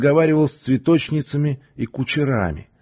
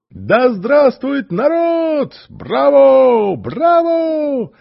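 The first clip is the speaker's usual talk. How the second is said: much higher, loud cheering; crowd cheering; 7 dB louder, much slower than usual. Acted. The crowd hails the stranger, high and loud cheering.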